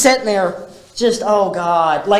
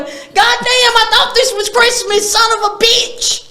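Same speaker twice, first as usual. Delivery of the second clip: higher, mock-exasperated cursing father; grumbling dad. Imitating dad griping at the family gathering, mock exasperation. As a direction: gripe like a fed-up dad at Christmas. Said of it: "my dad's just standing there going".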